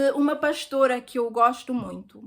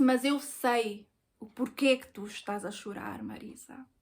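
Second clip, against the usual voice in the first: soft knowing reply; 4 semitones lower, 7 dB quieter than usual. Retold or imitated. Ex-boyfriend gently reveals he knows.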